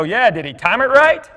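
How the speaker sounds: proud of the joke, ask it brightly; gleeful comeback. Retold or imitated. Dad answering the teacher, gleefully proud. Dad's joking reply to teacher.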